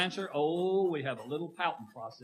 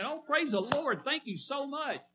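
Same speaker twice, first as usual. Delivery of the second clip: pitched way up, gushing "praise the Lord"; mock excited praise; 10 semitones higher than usual. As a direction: imitate people gushing over good news. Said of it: "we hear people say".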